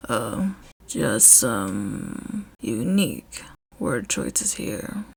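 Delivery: very low, soft voice; deep quiet Shota. A narrator's reading.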